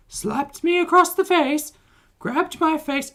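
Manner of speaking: very high, loud squeaky mimic; hammy high-pitched Mrs Doubtfire voice; Foodie Beauty voice. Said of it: "We'll do the Foodie Beauty voice".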